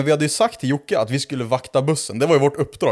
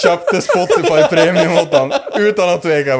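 real laughter, louder and higher; laughing while telling; 5 semitones higher, 6 dB louder than usual. Real, not acted. Teller confesses drunk purchase to friends, laughing loudly. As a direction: crack up through the words. Teller cracks up at own story.

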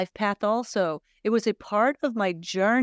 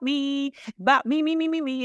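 mocking me me me; 7 semitones higher, faster than usual. Mocking self-centred chant.

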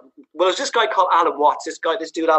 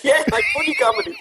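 mock reply; 11 semitones higher than usual. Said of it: "And I went".

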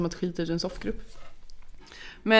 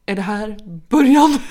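slow, much louder horrified question; aghast; 9 dB louder, slower than usual. Retold or imitated. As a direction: play the dad going pale with shock. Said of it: "Då blir han helt likblek". (långsam, chockad och mycket högre fråga)

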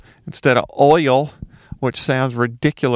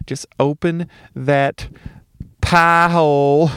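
Southern accent; slower than usual. Shown as a demonstration. Showing how to mimic the Southern accent. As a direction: open the mouth and drawl it Southern. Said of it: "If you wanna mimic the Southern accent".

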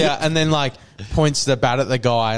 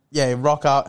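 ordinary talk

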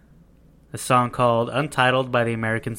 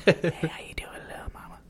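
breathy whisper in the ear; 5 semitones higher, 7 dB quieter than usual. Retold or imitated. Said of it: "You have to whisper the".

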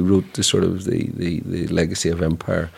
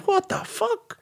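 high, squeaky imitated outrage; hammed-up shocked exclamation; mock disbelief. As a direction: play someone aghast at the news. Imagined outraged public reaction.

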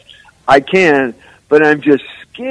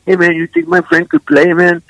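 lightly imitated Spanish-accented broken English, a bit higher; 6 semitones higher than usual. Stranger at the beach asks if his friend can play, in light broken English. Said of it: "I'm gonna try to say this in broken English".

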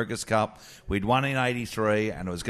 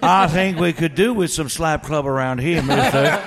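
put-on Southern accent; 6 semitones higher, 6 dB louder than usual. Comic inventing Tommy's man, loud playful Southern accent. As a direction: play a Southern heavy for Tommy, louder and higher. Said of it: "Tommy should speak in a Southern ac".

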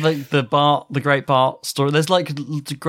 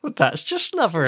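lightly put-on higher mock-English voice; Bart's English accent; 4 semitones higher than usual. Host echoes cartoon boy's line, light mock-English accent. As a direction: echo it in a slightly high mock-English accent. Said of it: "Bart speaks in an English accent".

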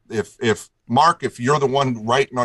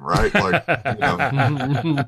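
laughing; 4 semitones higher, much slower than usual. Real, not acted. Laughing at his own awkward spot.

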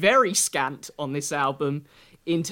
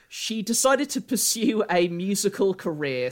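normal talking voice

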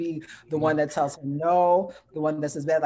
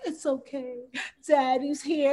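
mock cooing daddy; 9 semitones higher, 3 dB louder, slower than usual. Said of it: "she's like".

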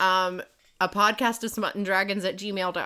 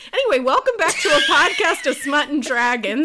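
big, loud podcast welcome; loud show intro; 6 semitones higher, 6 dB louder than usual. Real, not acted. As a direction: announce it loudly like a host.

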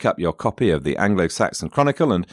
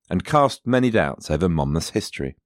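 ordinary talk

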